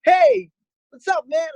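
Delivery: high, loud call across a distance; calling far away. Shown as a demonstration. Teacher calls to a distant friend to show projection. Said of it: "if you're calling someone who's far away".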